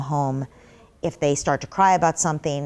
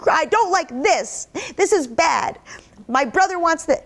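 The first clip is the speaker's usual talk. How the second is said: put-on whiny child; 10 semitones higher than usual. Child complaining to the parent about his brother, high whiny voice. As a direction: sulk like an upset child.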